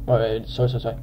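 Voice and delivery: normal talking voice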